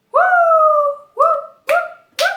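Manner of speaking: excited little woo cries; happy cheering. Real, not acted. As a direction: cheer with relief. Knee popped back, celebrating.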